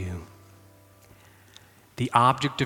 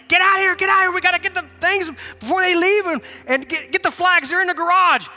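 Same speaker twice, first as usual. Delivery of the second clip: frantic urging; 6 dB louder than usual. Retold or imitated. Urging son to get flags.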